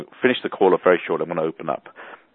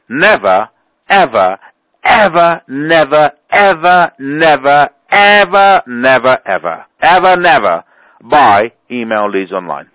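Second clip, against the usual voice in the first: never and ever hammered louder, climbing higher; emphatic warning; 5 semitones higher, 5 dB louder, slower than usual. Real, not acted. Host warning callers off bought email leads, emphatic hammering. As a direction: pound every never and ever like a drumbeat warning.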